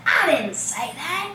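slow, drawn-out Southern accent on "I didn't say that"; exaggerated Southern accent, stretched slowly. Shown as a demonstration. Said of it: "I'm gonna extra give you my southern accent".

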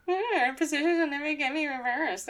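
high, sneering singsong mimicry; mock the villain's line back at him; mocking parody. Starscream mocks Megatron's words once he has left. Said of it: "Starscream's like".

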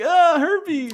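high laughing yelp about herpes; quoting Saul. Retold or imitated. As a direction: squeal it out through a laugh. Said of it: "he's like".

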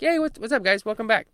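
high, sing-song fake welcome; mock cheery welcome. Team faking a cheery welcome back, mock sing-song. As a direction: gush it like a phony greeter.

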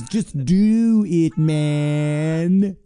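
slow, drawn-out slogan delivery; milk the slogan like a sports ad; slogan tagline. Acted.

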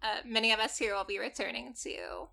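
normal talking voice

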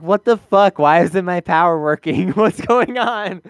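a monster whose power fails, lightly voiced; baffled monster. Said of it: "the monster's like".

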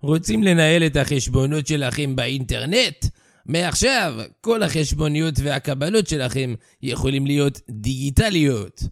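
Hebrew ad read in a French accent. Shown as a demonstration. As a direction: play a Frenchman reading a Hebrew ad. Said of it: "נא לקרוא במבטא צרפתי".

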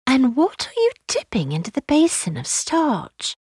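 put-on high, curious little girl; child's voice. Lucy asking about the washing, high curious child's voice. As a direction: ask high, like an inquisitive child.

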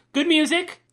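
light up on music; bright eager praise, rising on music; excited. Real, not acted. Listing highlights, voice jumps up.

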